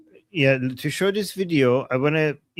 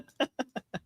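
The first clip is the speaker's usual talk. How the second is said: soft laugh; 10 semitones higher, 10 dB quieter than usual. Real, not acted. Cohost laughing at the joke.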